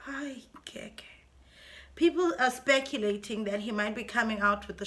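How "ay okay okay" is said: soft whispered okay; whispering. Real, not acted. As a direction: breathe it out in a whisper. Whispered after sigh.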